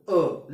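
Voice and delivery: अ ending immediately; short vowel. Shown as a demonstration. Teacher showing pupils the vowel ends quickly, short अ. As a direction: say अ briefly and stop. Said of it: "लगेच संपतो".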